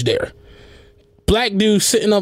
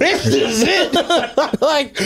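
very slow, laughing, high; 7 semitones higher, much slower than usual. Real, not acted. Echoing a friend's joke about Mobile, laughing hard. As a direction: stretch it out through the laughter.

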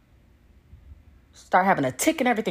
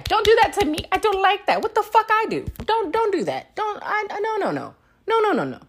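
squeaky pleading, much higher; high whiny plea; 13 semitones higher than usual. Acted. Begging the partner not to cancel, high and whiny. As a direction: beg like a wounded child.

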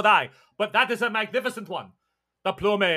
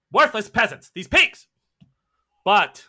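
scornful tyrant; 7 dB louder, slower than usual. Acted. Tyrant sneers at peasants.

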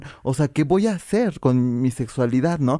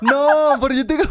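laughing; 12 semitones higher than usual. Real, not acted. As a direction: crack up while answering. Laughing at the joke.

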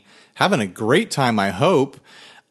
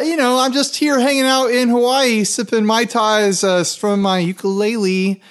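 hammed-up vacation bliss; 8 semitones higher than usual. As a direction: lay the tropical bliss on thick. Pretends to be on Hawaiian vacation.

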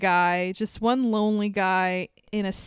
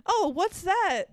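put-on much higher, slow curious child voice; little boy voice; 9 semitones higher than usual. The boy asking his dog what he sees, high and childlike. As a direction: ask like a small boy pointing. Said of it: "the little boy is like".

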